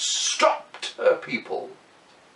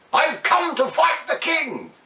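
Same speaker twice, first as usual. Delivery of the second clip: high, loud challenge at the gate; shouted; 4 dB louder than usual. Acted. Blackbird at the castle gate, shouting his challenge. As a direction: yell it at the castle door. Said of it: "and shouted".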